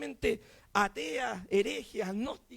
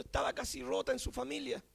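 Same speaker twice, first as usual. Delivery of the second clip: usual speaking voice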